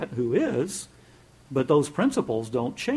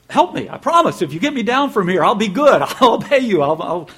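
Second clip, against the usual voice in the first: desperate bargaining; 7 semitones higher, 10 dB louder than usual. Retold or imitated. Jack bargaining with God.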